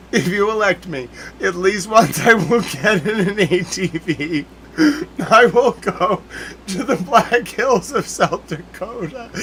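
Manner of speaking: high, loud and laughing through the words; cracking up at his own wild plan; giddy, breaking into laughs, slowing down; giggling excitement. Real, not acted.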